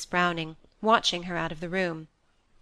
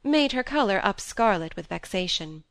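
plain reading voice

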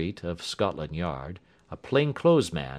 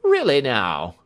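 raised, loud exclamation; surprised cry; 6 semitones higher, 8 dB louder than usual. A narrator's reading. Inspector reacting to Holmes's claim, crying out in surprise. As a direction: exclaim it, louder and higher. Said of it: "cried the inspector".

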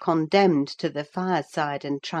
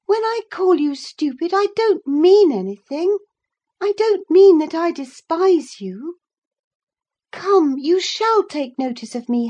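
high, loud, insistent pleading; persistent Catherine; 10 semitones higher, 6 dB louder than usual. A narrator's reading. Catherine demands her cousin acknowledge her, high and persistent. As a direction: press on as a headstrong young woman, pitched high.

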